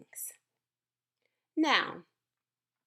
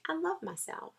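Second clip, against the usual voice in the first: soft affirmation; 7 semitones higher, 7 dB quieter than usual. Shown as a demonstration. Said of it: "you tell yourself that every day".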